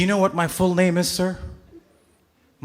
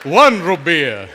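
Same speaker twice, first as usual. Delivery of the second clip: slow counting Dracula voice, laughing; 6 dB louder, slower than usual. Retold or imitated. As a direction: count like the Count from Sesame Street. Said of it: "Dracula is in the audience".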